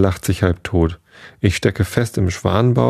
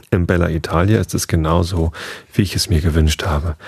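ordinary delivery

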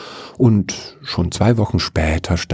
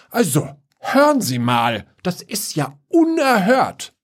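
put-on very high, outraged old woman; old woman scolding before she leaves; 11 semitones higher than usual. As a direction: huff off in righteous indignation. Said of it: "schimpfte die Oma".

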